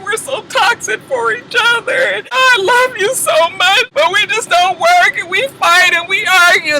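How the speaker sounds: high, whiny mock sob; high fake-tearful plea; mock crying. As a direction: cry it out in a hammed-up whine. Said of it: "She's crying".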